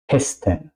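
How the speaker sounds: Kästen said with a short, open E; short clipped E in Kästen; short vowel. Shown as a demonstration. Teacher models Kästen for learners, short vowel. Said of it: "La E es corta".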